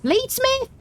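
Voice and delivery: high squeak of surprise; surprised. Real, not acted. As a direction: gasp it out, amazed. Spots a bladesmith.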